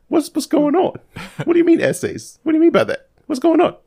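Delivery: high-pitched baffled questioning; high, incredulous string of questions; bewildered. Retold or imitated. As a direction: press for an answer in utter disbelief. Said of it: "looked at him with such confusion, such, such bewilderment".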